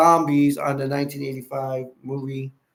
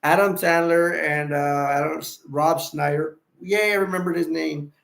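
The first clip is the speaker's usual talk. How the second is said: usual speaking voice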